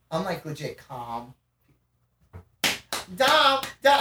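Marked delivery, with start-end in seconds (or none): 3.2-4.0 high, loud imitation of a friend; insistent retold call to rouse someone. Friend calling the person relaxing on the couch, insistent.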